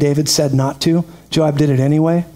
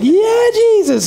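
high, slow exasperated "Yeah, Jesus"; drawn out and high, like a long-suffering groan; exasperated sigh; much slower than usual. Retold or imitated. Preacher mimicking an exasperated believer, high and drawn out.

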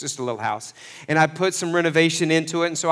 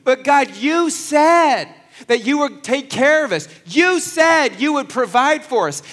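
higher and louder, pleading with God; pleading complaint to God; 8 semitones higher, 6 dB louder than usual. Pretend. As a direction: cry out to God as if he broke a promise. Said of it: "this is where I could have been like".

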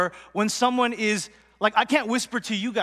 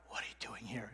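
low, very soft question; whisper; 12 semitones lower, 21 dB quieter than usual. Acted. God questioning Elijah after the display, whispered. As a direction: ask it in a hushed near-whisper.

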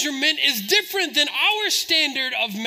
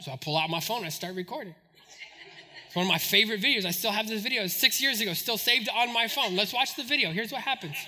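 ordinary talk

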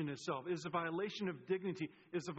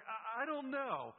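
high, drawn-out mock reluctance; hesitant, higher-pitched waffling; doubtful Moses; 7 semitones higher, slower than usual. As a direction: stammer it slowly, high with doubt. Moses voiced doubting God's call.

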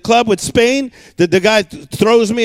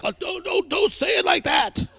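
crowd's mock protest; 7 semitones higher, 9 dB quieter than usual.

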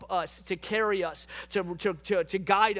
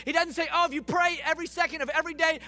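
preaching at full force; 4 semitones higher than usual. Real, not acted. Pastor assuring the congregation God never leaves, impassioned preaching.

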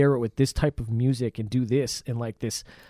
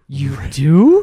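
surprised; 11 dB louder than usual. Retold or imitated. Drunk professor, surprised.

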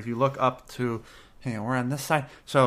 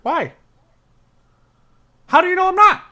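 startled; 10 dB louder than usual. Acted. Startled barkeep protests.

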